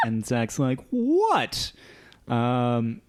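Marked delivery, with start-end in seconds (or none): 0.9-1.7 high, drawn-out imitated "what"; mock bafflement. Zach answering breathless Foggy from the stage, mock bafflement. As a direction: pitch it up like a baffled reply. Acts out Zach's baffled reply.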